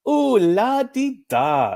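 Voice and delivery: high, slow, sing-song la di da; sassy mockery. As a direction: drag it out in a snooty mocking tone. Lion mocks human pride.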